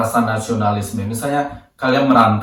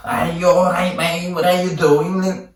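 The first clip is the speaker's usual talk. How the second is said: higher, broad local British accent; 8 semitones higher, slower than usual. Shown as a demonstration. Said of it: "Kalau misalnya saya ngomong bahasa Inggris". (logat Inggris lokal yang kental)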